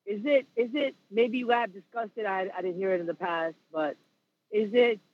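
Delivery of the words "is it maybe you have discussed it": normal talking voice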